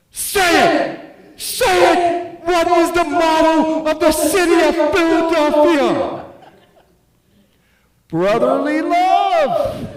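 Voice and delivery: high, loud screaming rant; Sam Kinison impersonation. Retold or imitated. Mom screaming at son for the motto, Kinison-style. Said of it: "with her best Sam Kinison impersonation goes".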